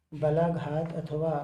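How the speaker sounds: normal talking voice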